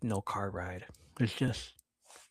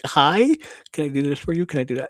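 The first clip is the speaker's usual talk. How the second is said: loud, high, over-eager offer that settles down; ass-kissing; 4 semitones higher, 7 dB louder, faster than usual. Retold or imitated. Kid kissing up to mom during silent treatment, ingratiating. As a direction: suck up eagerly, imitating a kid in trouble. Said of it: "I kissed so much ass".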